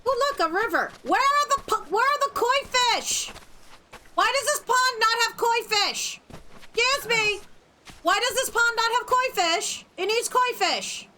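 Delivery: high, outraged complaint; indignant. Real, not acted. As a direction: demand the koi, appalled. Indignant rant about missing koi.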